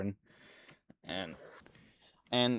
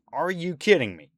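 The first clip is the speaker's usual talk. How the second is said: outraged; 6 semitones higher, 9 dB louder than usual. Real, not acted.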